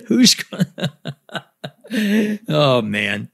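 real laughter cutting the words off; trying to talk through the laughter; laughing too hard to finish. Real, not acted. Laughing at the joke.

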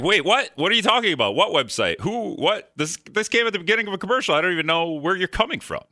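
hammed-up bewilderment. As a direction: rattle off baffled questions at the ad. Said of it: "And it's like".

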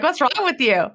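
high, mock-indignant question; mock annoyed asker. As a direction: play the puzzled, irritated friend. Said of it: "And they're like".

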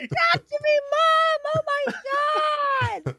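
put-on very high loud child squeal, slow on oh my God; thrilled kid. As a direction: gush like a starstruck little kid to mom.

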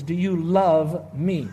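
slow question with weight on "love"; measured, word-by-word quoting; slow, deliberate quote. Retold or imitated. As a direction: draw out "love" and set "me" apart.

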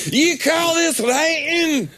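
high, slow put-on accent of a grumpy critic; mock European accent. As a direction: sneer it like a snooty foreign editor. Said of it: "He said".

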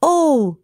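high, dismayed oh. Acted. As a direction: cry out in dismay. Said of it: "said the gingerbread man".

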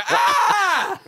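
very high mock shriek through laughter; imitated scream. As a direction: re-enact a scalded friend's howl. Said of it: "pick the oven lid up and go".

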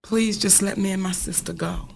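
hushed, pleading whisper to the gunman; frightened whispered plea to a gunman at the door; quiet plea. Retold or imitated. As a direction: beg softly under your breath. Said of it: "And I said".

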